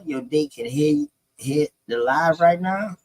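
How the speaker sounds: usual speaking voice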